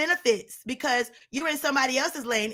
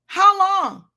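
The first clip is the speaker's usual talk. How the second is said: louder and higher, peak of the build; climactic cry; 5 semitones higher, 7 dB louder than usual. Retold or imitated. Preacher voicing God's question to Saul, climactic loud cry. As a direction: drive the question to its top. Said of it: "that asked Saul".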